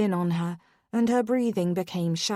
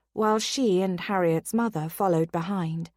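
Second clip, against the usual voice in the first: plain reading voice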